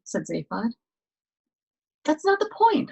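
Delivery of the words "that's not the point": much higher, louder protest. Acted. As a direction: push back sharply, high and loud. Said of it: "Arthur protested".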